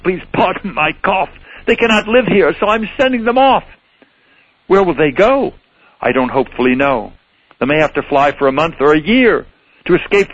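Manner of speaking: high, cross Lorax voice; snappish Lorax. Acted. Lorax lecturing the Onceler about smog, snappy. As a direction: lecture him as the fussy Lorax. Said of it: "snapped the Lorax".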